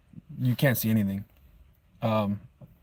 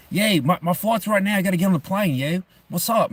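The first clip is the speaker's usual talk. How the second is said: higher-pitched mock Philly accent; crazy Philly accent; 8 semitones higher than usual. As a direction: imitate a drunk Philly guy running late for a flight. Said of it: "He had a crazy Philly accent".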